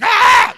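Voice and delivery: high, loud excited "Ah!"; loud happy cry; excited yell. Retold or imitated. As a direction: shout it like a welcoming crowd. Everyone's reaction voiced loudly.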